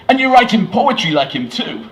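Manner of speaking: loud, high, eager American; bad American accent. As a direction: ask in a hammed-up American accent, impressed. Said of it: "a terrible American accent".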